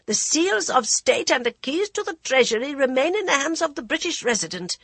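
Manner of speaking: high, commanding royal voice; authoritative dowager queen. A narrator's reading. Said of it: "strong with authority".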